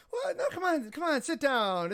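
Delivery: put-on insistent old lady. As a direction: wave him over to the table, pressing kindly. Elderly lady insisting he sit.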